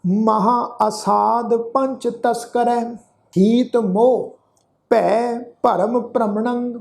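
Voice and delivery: slow, measured verse reading with held vowels; drawn-out devotional reading; scripture recitation. Shown as a demonstration. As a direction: recite the sacred verse slowly, stretching the endings.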